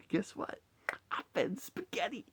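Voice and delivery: a mock menacing whisper; whispered villain line. Quoting the Avatar villain to viewers, hushed whisper. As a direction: breathe it like a threat. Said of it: "where he's just like".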